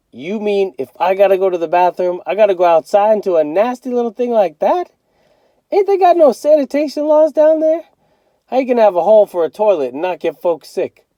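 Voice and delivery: put-on high, outraged teen griping; disbelieving big brother, high and loud; indignant kid voice. A narrator's reading. Byron complains about outhouse.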